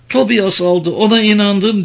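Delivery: high, upset confession; high voice, close to breaking down; distressed captive woman. A narrator's reading. Captive admits she trusted him, trembling.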